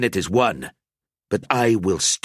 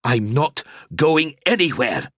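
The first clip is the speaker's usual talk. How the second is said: louder and higher, building to a plea; snapped then pleading; 7 semitones higher, 4 dB louder than usual. A narrator's reading. Gruber begs Al-Azir for answers, building louder. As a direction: rise from defiance into begging.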